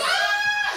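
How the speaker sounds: high, loud mock fangirl scream; crowd scream. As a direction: shriek like an adoring audience. Crowd screams for smooth rival.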